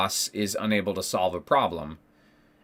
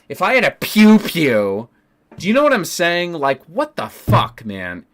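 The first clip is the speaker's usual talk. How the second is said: loud, heated rant; angry outburst; 5 semitones higher, 6 dB louder than usual. Real, not acted. Fed-up teacher venting on stream about boss, loud angry rant. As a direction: blow up at the boss, louder.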